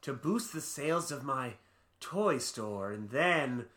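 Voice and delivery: slow, hammed-up evil monologue; cartoon villain gloating over his plan; mock villain voice. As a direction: play a scheming toy tycoon, slowly. Voicing a villain toy store owner.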